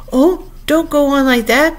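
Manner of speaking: high, slow distressed plea; crying out in despair. A narrator's reading. Queen begs the tearful Alice to stop, despairing cry. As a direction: plead in drawn-out dismay. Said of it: "cried the poor Queen".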